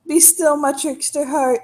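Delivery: high, theatrical swoon of delight; delighted trickster. Acted. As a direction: swoon over a clever trick, pitched high. Trickster swoons over a clever trick.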